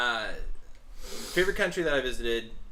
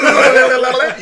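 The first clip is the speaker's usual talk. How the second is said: ordinary talk, nothing marked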